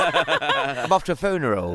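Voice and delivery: put-on slow, laughing Northampton accent. Alan mocking his hometown's Northampton accent. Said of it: "how they talk in Northampton".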